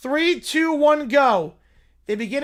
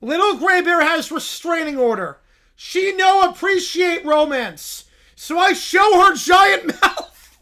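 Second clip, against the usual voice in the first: higher, louder, slow dim bear shout; 7 semitones higher, 7 dB louder than usual. Acted. Little Brown Bear shouting about romance, slow and loud. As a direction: bellow it like a lovesick bear.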